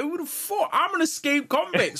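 high-pitched retold challenge; mock indignant outburst. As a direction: act out cocky outrage, pitched well up. Retelling a confrontation at party.

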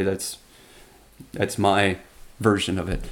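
ordinary talk, nothing marked

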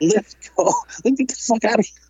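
high, laughing as the words come out; laughing urgency. Retold or imitated. Teller pulling his brother away, laughing. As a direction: hurry someone along through helpless laughter.

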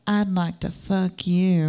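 slow, soft southern drawl; southern accent. Shown as a demonstration. Proving she grew up in the South, soft southern drawl. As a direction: stretch it out like a true Southerner. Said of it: "I grew up in the South".

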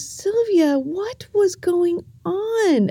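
a hint of a worried uncle holding her; caring uncle. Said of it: "he gives me a hug and he goes".

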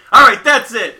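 loud, high declaration; fed-up announcement. Retold or imitated. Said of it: "He's like".

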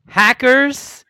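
loud, high announcer call; hype announcement. Real, not acted. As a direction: belt the title like a ring announcer. Announcing the matchup.

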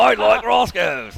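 loud, slow, high mock-Kiwi accent; hammed-up Kiwi holler, stretched out; Scott Dixon. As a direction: drag it out in a badly faked Kiwi accent. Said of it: "that's not how he sounds".

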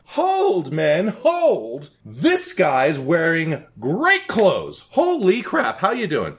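Caligula giddy. Acted. Caligula halts his men and greets the guests, high and giddy. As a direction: gush like a delighted coked-up emperor.

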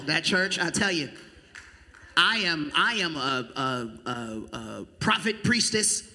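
ordinary talk, nothing marked

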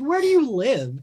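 high-pitched retold disbelief; baffled question. Asking the island mother, baffled retold disbelief. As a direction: rise high on an incredulous question.

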